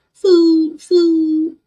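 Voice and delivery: high, loud drawn-out call; playful pet talk. Pretend. Owner offering wet food to the cat, high loud pet talk. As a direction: sing out to a hungry pet.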